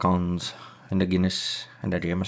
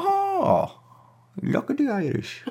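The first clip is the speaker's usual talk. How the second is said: much higher, put-on delighted squeal; mock gushing; 4 dB quieter, slower than usual. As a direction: coo over it like a giddy fan. Gushing over the Irish song.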